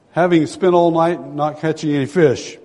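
ordinary delivery

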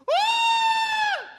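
piercing high scream of terror; panicked scream. Retold or imitated. Passengers screaming as the plane drops, shrill scream. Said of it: "people going".

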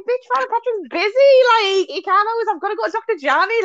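high, comic exasperation; mock sassy comeback. Retort to the exorcism idea, mock exasperated.

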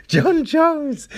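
high, excited hype call of the name; high-pitched mock announcement. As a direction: sing out the name like a fan. Mocking TC's call.

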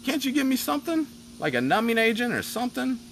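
mock outrage, voice jumping way up; incredulous outburst. Patient protests to the doctor, high disbelieving squeal. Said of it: "I'm like".

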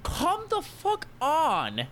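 high, drawn-out mock pleading; exasperated plea. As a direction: beg in hammed-up exasperation, stretching the words. Acted exasperated plea to passive teacher.

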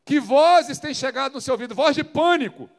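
loud, impassioned sermon delivery; full force preaching. Acted. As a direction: preach it to the congregation with fire. (pregação inflamada e intensa)

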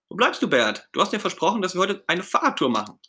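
high, eager, impatient call; excited Ernie. Acted. Ernie waiting for Bert to start the promised bike tour, excited. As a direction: ask eagerly in a high character voice. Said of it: "Ernie ist schon ganz aufgeregt".

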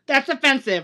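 high, loud mock offended cry. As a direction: play the shocked, offended audience member. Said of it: "people come up and be like".

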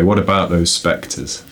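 ordinary delivery

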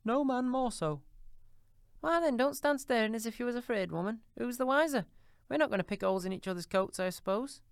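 put-on high, coarse old charwoman, brazen; a bold thieving charwoman, defiant. A narrator's reading. Charwoman's voice in the story.

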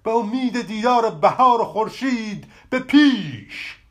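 rally onward with fresh strength; rallying shout. A narrator's reading. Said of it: "فریاد زد".

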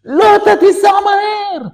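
high, loud screech; screamed demand. Retold or imitated. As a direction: yell at the driver as the hysterical wife. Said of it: "צעקות". (צעקה היסטרית)

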